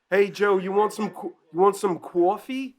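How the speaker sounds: high-pitched imitation Boston accent, "cwoffee"; hammed-up Massachusetts coworker offering coffee. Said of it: "think of the Boston accent".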